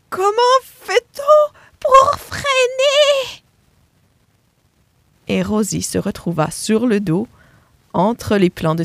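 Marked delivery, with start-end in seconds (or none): 0.1-3.4 very high, loud, slow panicked squeal; panicked cry. Acted. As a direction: scream it as she loses control. On entendit un cri.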